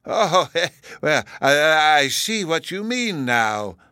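high, slow, dithering concession; stammering, genial professor's voice; gracious. A narrator's reading. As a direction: concede graciously after a chuckle. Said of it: "the other professor graciously remarked".

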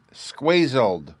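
put-on child's high correction; interrupting. A narrator's reading. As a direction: cut in with the made-up word. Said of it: "Bruno interrupted".

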